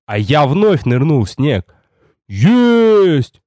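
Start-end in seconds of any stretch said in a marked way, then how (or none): 2.3-3.4 high, drawn-out triumphant cry; burly warrior's elated shout; joyful bark. A narrator's reading. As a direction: bellow in delighted triumph. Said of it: "обрадованно рявкнул Рикар".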